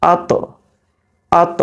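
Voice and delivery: আত with a bouncing stop on the final t; qalqala on আত. Shown as a demonstration. Teacher showing students the qalqala sound. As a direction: drop the t of আত with a small echo. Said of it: "এভাবে একটা drop হবে".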